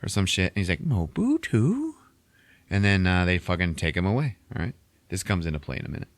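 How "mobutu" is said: "Mobutu?" drawn out slowly, much higher, rising at the end; confused, high, slow "Mobutu?" with a rising close; puzzled echo. Retold or imitated. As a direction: repeat "Mobutu?" as a baffled question. Bodhi repeats word, puzzled.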